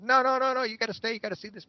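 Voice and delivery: high-pitched imitated plea to stay; urgent pleading. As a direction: plead excitedly, voice jumping right up.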